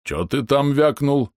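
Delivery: slow, high gruff threat; menacing growl. Acted. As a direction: challenge him slowly. Bom menacing retort.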